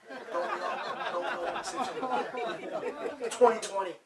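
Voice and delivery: words breaking into laughter, pitch climbing; laughing through words. Real, not acted. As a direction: crack up at your own joke. Laughing at own joke.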